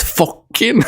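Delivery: high-pitched mock Scottish accent. Streamer insisting on the misheard lyric, amused mock Scottish accent. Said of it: "she says in a Scottish voice".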